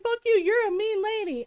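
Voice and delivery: high-pitched imitation of an offended boyfriend; mock indignant retort. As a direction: lash out in mock hurt. Retold boyfriend's insult, second telling.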